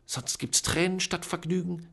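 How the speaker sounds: high, sharp hissed threat. A narrator's reading. Aunt threatening Charlie with tears, hissing. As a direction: spit the warning at him. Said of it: "zischte Tante Eustacia".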